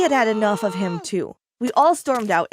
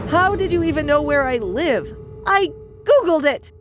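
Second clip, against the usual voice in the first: angry; 6 semitones higher than usual. A narrator's reading. Angry quarrel between lovers.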